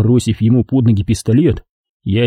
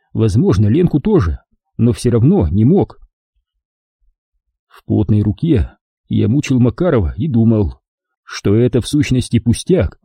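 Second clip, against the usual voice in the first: usual speaking voice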